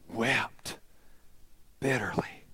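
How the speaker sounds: soft, breathy, hushed grief; hushed sorrow. Acted. Preacher telling Peter's weeping, hushed and soft. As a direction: let the words fall to a hush.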